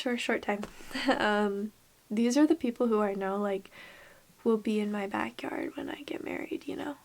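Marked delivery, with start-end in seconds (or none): 4.4-6.9 whisper. Real, not acted. Emotional confession, whispered.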